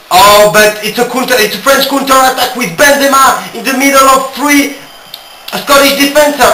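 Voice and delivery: fast-flowing football commentary full of oh's; excited match commentator calling the game; live play-by-play. Acted. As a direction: call the action as it happens.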